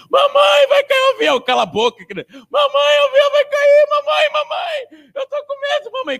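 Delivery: put-on very high, loud child's cry for mom; screaming scared child. Little girl on the turbulent plane screaming for her mother, very high. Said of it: "Começou a gritar, a chorar".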